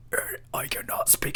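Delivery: unvoiced, breathless whisper; imitating grandfather's voiceless whisper. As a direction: breathe it out with no voice, like a man who lost his voice. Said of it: "And he sounded like".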